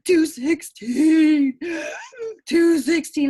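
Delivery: high, loud, slow sobbing cry; retold sobbing. Mother calling the psychic, sobbing hysterically. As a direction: wail the numbers through tears. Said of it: "hysterically sobbing".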